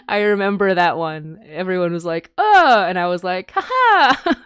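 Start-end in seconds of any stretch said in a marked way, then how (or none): 2.4-2.8 high loud startled oh; mock gasp. Imitating the disturbed listeners with a high oh. 3.5-4.4 high, loud gleeful ha ha; mock delighted laugh. Retelling her delight at the gory entry.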